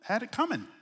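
soft, offhand shrug of a line; shrugging God. Retold or imitated. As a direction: say it like a shrug, no concern. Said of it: "God sometimes goes".

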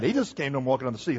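fast, offhand toss-off with stress on just; nonchalant throwaway. Shown as a demonstration. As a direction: toss it off like it's nothing. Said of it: "the nonchalant way the writer just throws that".

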